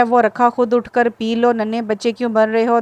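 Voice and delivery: plain reading voice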